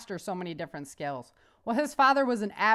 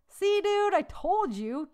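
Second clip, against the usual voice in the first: elated; 9 semitones higher, slower than usual. Acted. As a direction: crow it to your buddy, beaming. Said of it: "Johnny elated".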